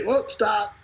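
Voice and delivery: high-pitched mock halt; mock alarmed client. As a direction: call a sudden halt, playfully high. Acts out clients halting the leads.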